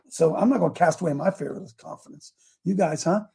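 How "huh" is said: ordinary delivery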